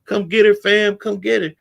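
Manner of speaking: imitated guy calling to come get her; imitating a pleading dude. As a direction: beg the friend to pull her off. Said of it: "So he was just like".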